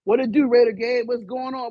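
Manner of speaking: ordinary talk, nothing marked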